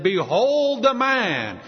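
loud, drawn-out, high proclamation; proclaiming to crowd. Retold or imitated. Pilate presenting Jesus to the crowd, proclaiming loudly. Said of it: "trying to play upon their sympathy".

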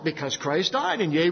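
normal talking voice

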